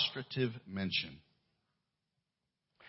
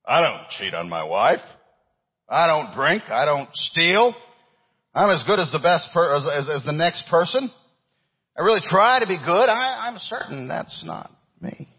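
raised, self-justifying protest; mock protest; 7 semitones higher, 7 dB louder than usual. Preacher voicing a churchgoer who objects, mock indignant protest. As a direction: plead your own good record.

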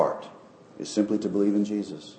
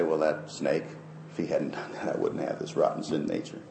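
a hint of a sulky sinner, softer; mock grumble; 5 semitones lower, 9 dB quieter than usual. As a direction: mutter the excuse under your breath. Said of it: "we'd like to say".

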